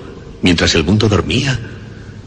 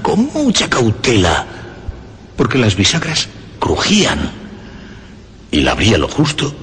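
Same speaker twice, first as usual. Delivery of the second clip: ordinary delivery, nothing marked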